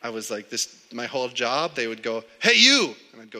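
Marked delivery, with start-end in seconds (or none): 2.4-2.9 loud, high imitation of a foreman calling out; bossy call. Boss to summer laborer, bossy shout. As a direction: holler it like a boss summoning a worker. Retells boss ordering him around.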